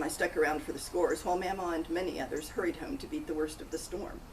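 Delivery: plain reading voice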